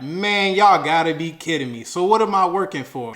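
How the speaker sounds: ignorant outburst. Retold or imitated. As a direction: rant at the bank in disbelief. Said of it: "And I'm ignorant".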